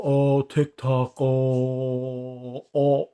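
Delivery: slow, drawn-out dazed moaning; mock a brain-dead phone addict, dragging and swelling; mocking zombie drone. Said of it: "All you know how to do is go".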